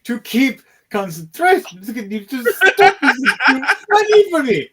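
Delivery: high, laughing wizard who can't concentrate; a giggling wizard losing his focus. Acted. Wizard to the party, laughing helplessly. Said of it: "even the wizard's like".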